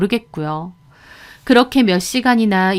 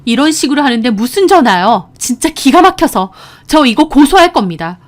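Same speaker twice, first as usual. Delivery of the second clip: raised, higher indignant voice; angry shouting back; 4 semitones higher, 6 dB louder than usual. A narrator's reading. Daughter-in-law threatening to sue, angry raised voice. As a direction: argue back loudly and indignantly. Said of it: "저도 고함 질렀어요".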